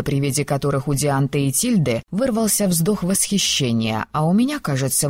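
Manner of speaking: usual speaking voice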